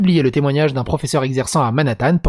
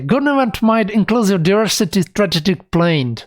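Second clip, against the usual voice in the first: hammed-up English accent on the phrase; 4 semitones higher than usual. Shown as a demonstration. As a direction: show off a posh English pronunciation of "Government-wide inclusive diversity strategic plan". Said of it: "c'est facile d'avoir un accent anglais". (accent anglais exagéré)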